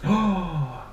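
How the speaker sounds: animated read-aloud with big emphatic key words; story narration. A narrator's reading. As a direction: read dramatically to young children. Reads story aloud to children.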